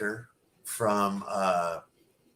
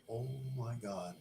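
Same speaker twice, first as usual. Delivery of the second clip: shocked under the breath; 15 dB quieter than usual. Retold or imitated. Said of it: "And I was like".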